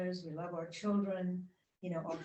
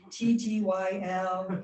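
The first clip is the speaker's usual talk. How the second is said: laughing spell-out; 7 dB louder, slower than usual. Real, not acted. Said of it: "I'm still baffled".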